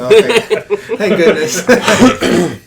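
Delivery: loud, high laughing relief. Real, not acted. Player relieved the insult went unheard, laughing. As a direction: drag it out through laughter.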